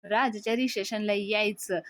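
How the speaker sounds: usual speaking voice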